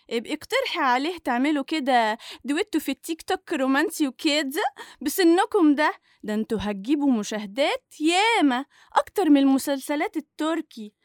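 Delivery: ordinary talk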